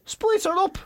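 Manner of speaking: high-pitched egging-on mates; mock single mates. Single mates egging him on, mock high voice. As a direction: pitch it right up as the cheering mates. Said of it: "your single mates are there".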